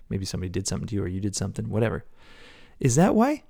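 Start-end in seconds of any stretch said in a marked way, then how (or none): 2.8-3.4 incredulous cry. Real, not acted. Rhetorical challenge in sermon.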